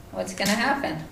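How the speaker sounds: ordinary talk, nothing marked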